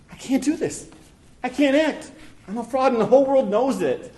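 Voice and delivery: high, rising panic; panicked self-doubt. Acted. Acts out his panicked dark thoughts.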